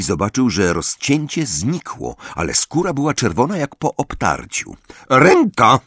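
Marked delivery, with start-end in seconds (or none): 5.1-5.8 high, loud one-word order; teacher's imperious command. A narrator's reading. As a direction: demand it sharply, voice raised. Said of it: "rozkazała".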